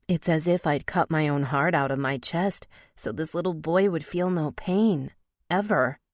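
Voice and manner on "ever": ordinary delivery, nothing marked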